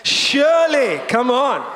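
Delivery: slow, proclaimed scripture reading; congregational reading. Real, not acted. Pastor leading the church in reading Psalm 23, slow and proclaimed. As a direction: read it aloud together, slow and declared. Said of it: "read this all together in a loud voice".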